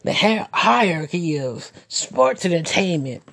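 mock-reverent quote of the Belt God's big words; a touch of sarcastic awe; mocking the friend's grand phrase. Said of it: "as the Belt God said".

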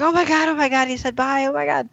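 high, excited retold gushing; thrilled disbelief. Ross reacting to his son saying bye, thrilled. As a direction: imitate an overjoyed parent, pitch way up.

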